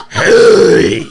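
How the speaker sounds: a loud mock yell of a furious father; angry dad shout. Son imitating his dad yelling at the neighbor. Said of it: "He says".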